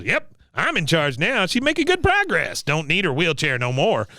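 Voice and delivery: high self-satisfied veteran impression; cranky old man taking command; Cotton. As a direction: boast like an old soldier, pitch leaping up. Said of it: "He goes".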